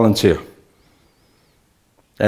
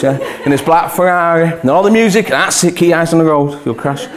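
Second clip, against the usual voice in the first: ordinary talk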